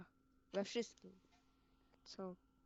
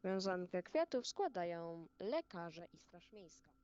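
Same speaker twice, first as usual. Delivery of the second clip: ceremonial announcement. Acted. Announces wreath laying ceremonially.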